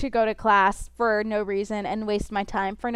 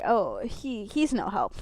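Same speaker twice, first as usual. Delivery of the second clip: normal talking voice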